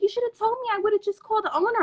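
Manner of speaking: high-pitched voicing of a friend; imitated friend. Storyteller voicing her friend afterwards, imitated friend. Voices friend's earnest remark.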